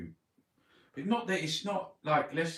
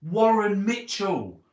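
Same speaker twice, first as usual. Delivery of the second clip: excited recall; 9 semitones higher, 9 dB louder, slower than usual. Real, not acted. Naming the actor excitedly.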